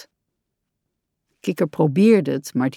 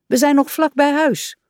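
high-pitched impatient protest; exasperated and raised; 8 semitones higher, 3 dB louder than usual. A narrator's reading. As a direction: object impatiently, pitch lifted. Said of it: "zei Rad ongeduldig".

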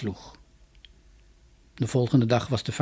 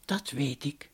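much softer, calm and knowing; soft cloud mannikin; 11 dB quieter, slower than usual. Acted. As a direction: murmur it knowingly. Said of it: "zei het wolkenmannetje". (zacht en wetend)

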